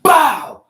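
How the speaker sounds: loud high smack sound; slap noise. Retold or imitated. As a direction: fire it out like a hard slap. Imitating colonel's slap.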